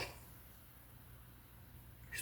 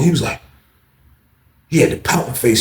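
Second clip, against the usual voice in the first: usual speaking voice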